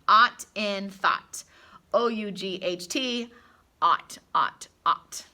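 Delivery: ought drawn out loud and slow; loud, slow ought for the class; ought sound. Shown as a demonstration. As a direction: stretch out ought. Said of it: "So say that with me".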